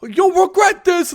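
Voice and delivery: high, drawn-out loud threat; Penguin. Acted. Penguin threatening Batman as he leaves, high yell. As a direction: yell a hammed-up villain threat. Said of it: "if he's like".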